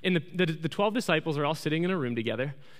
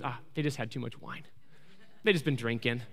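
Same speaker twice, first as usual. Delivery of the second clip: lower, softer offhand aside; dismissive buddy; 6 semitones lower, 7 dB quieter than usual. Retold or imitated. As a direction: brush it off quietly, imitating a skeptical buddy. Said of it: "his buddy leans back and he goes".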